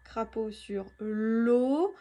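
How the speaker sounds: crapaud sur l'eau said slowly, leaning on the O of l'eau; slow teacher reading with l'eau stretched; slow, stressed O. Shown as a demonstration. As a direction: draw out the O in l'eau. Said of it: "et l'eau".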